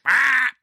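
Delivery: sudden high loud shriek; mock duck shriek. Imitating the terrifying boat duck with a sudden shriek. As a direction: blurt a startled yelp like the puppet duck. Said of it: "just be like".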